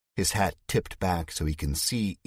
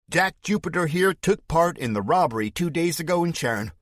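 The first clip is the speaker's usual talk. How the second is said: Spike's fake Southern accent; 6 semitones higher, 5 dB louder than usual. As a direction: denounce him to the crowd in a put-on Southern voice. Villain accusing hero before crowd.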